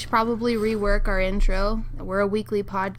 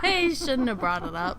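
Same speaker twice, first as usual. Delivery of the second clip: laughing while talking; 4 semitones higher than usual. Real, not acted. Laughing after the joke.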